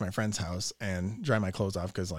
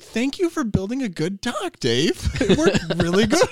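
slow, much higher and louder thanks; hammed-up gratitude; mock-grateful; 11 semitones higher, 8 dB louder, slower than usual. Thanking Dave for his dock, slow mock-sincere. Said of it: "And I was like".

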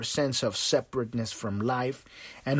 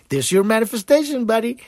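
higher, louder teasing voice; smug universe; 8 semitones higher, 5 dB louder than usual. Retold or imitated. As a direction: gloat like a cosmic prankster. Universe taunting the listener.

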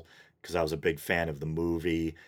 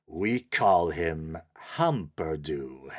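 put-on slow, drawn-out German-accented announcement; 2 semitones lower, much slower than usual. German-accented character suddenly naming the messiah, in a slow German accent. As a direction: say it slowly, as a German character would. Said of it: "this character with the German accent".

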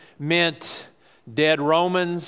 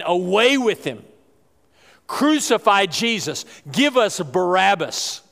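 higher, emphatic mock yell; retold crowd yell; 5 semitones higher, 4 dB louder than usual. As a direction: call it out like a demanding crowd, short of screaming. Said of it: "they were yelling".

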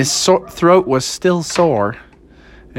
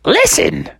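high cartoon mother cue; high-pitched mom voice; Froggy's mom. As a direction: alert them in a high falsetto. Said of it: "Froggy's mom was like".

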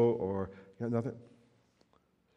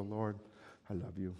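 quiet prayer; 2 semitones lower, 8 dB quieter than usual. Pretend. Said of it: "just stop and go".

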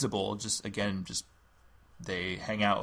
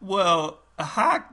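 high Southern belle greeting; sweet Southern drawl; Southern lady voice; 6 semitones higher, 3 dB louder, slower than usual. Acted. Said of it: "role play Carolyn and another overlord".

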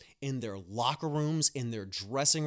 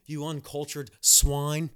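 mock outrage; 2 semitones higher, 7 dB louder, slower than usual. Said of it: "went off".

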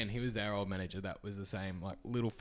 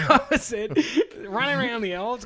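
ordinary talk, nothing marked

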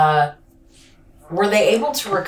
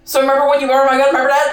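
mock blunt question; 7 semitones higher, 6 dB louder, much faster than usual. Mocking detective's blunt question.

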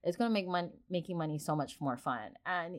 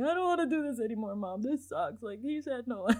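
mock-whiny child, higher and fast; hammed-up child's complaint, pitched up; whiny crying kid; 5 semitones higher than usual. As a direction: imitate a kid upset after a no. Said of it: "like, crying".

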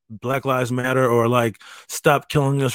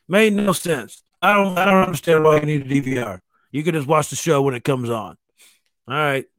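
usual speaking voice

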